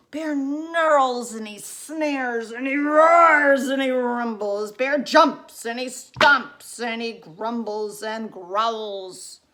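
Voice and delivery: loud, slow, rumbling storyteller; growly narration. A narrator's reading. Reader acts out the angry woken bear, loud and slow.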